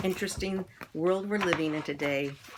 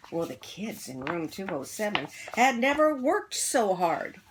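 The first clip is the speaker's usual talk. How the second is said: ordinary delivery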